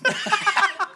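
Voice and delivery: a sudden high burst of laughter. Real, not acted. Laughing at the all-women taxi joke. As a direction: let a high cackle slip out.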